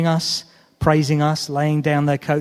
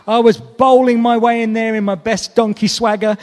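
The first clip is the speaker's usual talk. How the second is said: put-on higher, bouncy, excited donkey voice, no accent; a proud little donkey boasting about his big day; donkey telling his story; 6 semitones higher than usual. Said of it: "through the eyes of the donkey".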